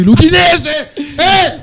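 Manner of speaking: high, loud laughing yell; real shout. Real, not acted. Fan yelling about the Udinese game while hosts laugh, loud. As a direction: burst out yelling through laughter. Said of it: "No, non urlare!".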